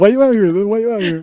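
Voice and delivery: high, loud mock heckling; loud, high-pitched mock demand; mock confrontation. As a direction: shout it high like a playful challenge. Mock confrontation about the protest.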